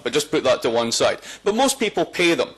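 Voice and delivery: ordinary delivery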